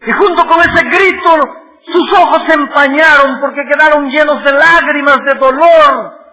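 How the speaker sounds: loud, high, ringing sermon delivery; impassioned pulpit cry; full-force preaching. Acted. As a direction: preach it out to the back pew. Narrating Jacob's cry of pain.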